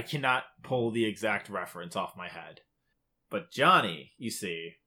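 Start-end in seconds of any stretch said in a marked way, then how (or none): none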